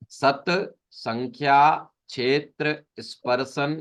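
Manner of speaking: "सत संख्या क्षेत्र स्पर्शन" said slowly with each word set apart. Shown as a demonstration. Teacher breaks the compound into words for the class, slowly. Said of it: "अब इसको संधि विच्छेद करते हैं।".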